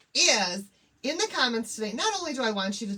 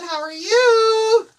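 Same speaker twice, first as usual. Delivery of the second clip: high, loud, slow sing-song greeting; excited stretched greeting; gushing hello; 10 semitones higher, 12 dB louder, much slower than usual. Real, not acted. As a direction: beam it at a friend. Greeting viewer warmly.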